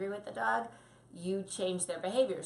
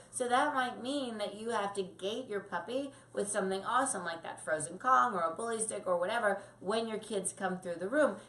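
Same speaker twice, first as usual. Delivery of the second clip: usual speaking voice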